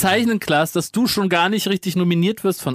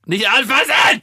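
warning shout; 5 semitones higher, 4 dB louder, slower than usual. Real, not acted. Grabbing lemons, warning.

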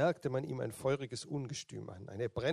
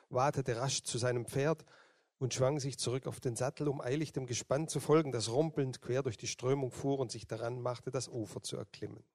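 usual speaking voice

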